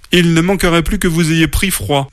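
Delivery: high-pitched motherly fussing; displeased grumbling. A narrator's reading. Mother bear after washing her muddy cubs, displeased. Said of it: "marmonna maman ours, pas contente du tout".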